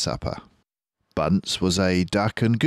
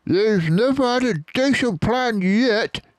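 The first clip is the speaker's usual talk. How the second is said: much higher, louder sneer; Bunce scoffing; 4 dB louder than usual. Acted. Bunce mocking Bean's plans, high sneering voice. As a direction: scoff at him. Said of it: "said Bunce".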